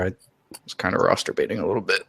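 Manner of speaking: ordinary delivery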